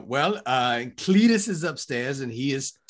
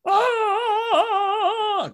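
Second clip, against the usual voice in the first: high yelp of alarm; scream; 5 dB louder than usual. Acted. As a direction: shriek before the crash. About to crash into tree.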